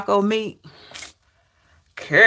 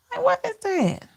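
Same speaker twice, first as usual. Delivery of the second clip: high, soft disbelief; incredulous; 12 semitones higher, 6 dB quieter than usual. Real, not acted. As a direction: ask it high and baffled. Disbelief question.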